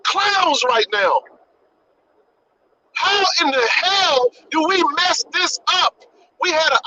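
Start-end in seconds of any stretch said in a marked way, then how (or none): 3.0-6.0 slow, hard-hit exasperated question. Real, not acted. Ranting at own community about a lost chance, exasperated. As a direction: demand an answer, drawing the words out.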